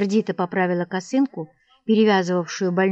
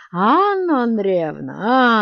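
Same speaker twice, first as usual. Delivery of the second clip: slow, loud drawn-out call; loud stretched call to the wife; 3 semitones higher, 5 dB louder, much slower than usual. A narrator's reading. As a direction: call her from the next room. Calling wife from afar.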